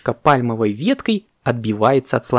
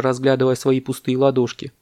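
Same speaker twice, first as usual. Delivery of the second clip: plain reading voice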